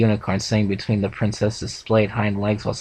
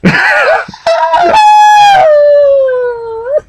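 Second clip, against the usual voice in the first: shrieking laugh; 11 dB louder than usual. Real, not acted. Bursts out at clapping joke.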